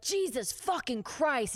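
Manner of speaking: high, drawn-out exasperated cursing; boss's angry outburst. Retold or imitated. Abusive boss reacting to a waitress's mistake, mock outburst. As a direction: imitate an exasperated boss blowing up.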